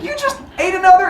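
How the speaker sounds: shocked disbelief. Retold or imitated. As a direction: gasp it in shock. Rhea shocked.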